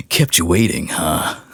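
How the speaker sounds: Solid Snake impression with a rough sick voice; rough soldier line, Metal Gear style. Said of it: "the best Snake voice I've ever had".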